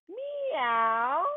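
drawn-out rising meow; cat meow. Shown as a demonstration. Teacher leading kids in cat pose, meowing. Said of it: "make the sound of a cat".

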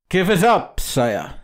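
high, forceful order, dropping on the last word; angry command. Acted. Captain seizing his cane to demand the papers, angry. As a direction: punch it out as a threatening demand.

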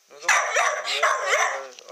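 very high, loud, slow stretched squeal; squealing with excitement; excited squeal. Real, not acted. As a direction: hype the dog up for a walk.

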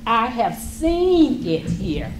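usual speaking voice

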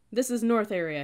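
yelling. A narrator's reading. Cadet to recruits scampering behind him, yelling. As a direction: yell back to those following. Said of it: "he yelled as we scampered behind him".

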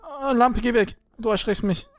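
high, startled plea. Real, not acted. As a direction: jump at the lamp and shoo it away. Startled by lamp.

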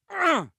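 high grunt of pain; short, strained yelp. Acted. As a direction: grunt as if hit. Hit by friendly fire.

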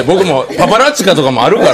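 normal talking voice

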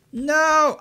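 high, loud drawn-out protest; loud, high objection; dismayed cry. Real, not acted. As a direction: wail it in dismay. Protest on hearing a dog.

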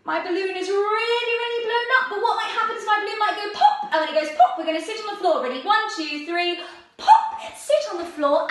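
high, loud, tense balloon build-up; excited play-acting. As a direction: build playful suspense up to a loud pop. Pretend balloon about to pop.

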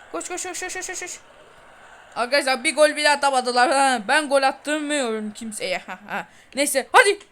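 usual speaking voice

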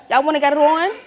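loud taunting challenge to fight; angry challenge. Real, not acted. Teen angrily dares heckler in audience to fight. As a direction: dare them, loudly.

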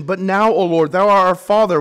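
loud, ringing sermon voice; booming pulpit call; full-force preaching. Acted. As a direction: preach it out to the whole church.

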